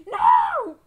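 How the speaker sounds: very high, very loud scream; dramatic scream. Pretend. Player losing teammate Mike in game, dramatic scream. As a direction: scream it at full volume. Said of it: "I'm sorry if I really screamed that loud".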